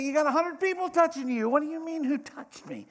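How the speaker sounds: high, loud incredulous protest; incredulous disciples. Retold or imitated. Disciples scoffing at Jesus in the crowd, loud and incredulous. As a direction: scoff that he even asks.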